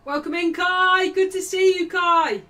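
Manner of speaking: loud, high-pitched hail; high, full-voiced greeting; shouted welcome. Real, not acted. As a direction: shout a big welcome to someone arriving. Greeting a viewer joining the stream.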